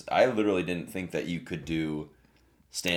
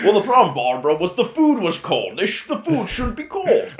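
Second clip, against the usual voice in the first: Sean Connery impression; 12 semitones higher, 9 dB louder than usual. Connery parody shouting.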